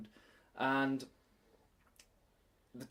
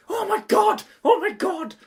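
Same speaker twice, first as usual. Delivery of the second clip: much higher and louder excited squeal; kids squealing; 12 dB louder than usual. Retold or imitated. Imitating kids panicking about a bird, high excited squeals. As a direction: shriek like excited children. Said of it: "they were all running around going".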